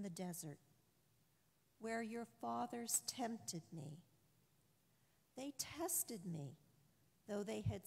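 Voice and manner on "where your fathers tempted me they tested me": usual speaking voice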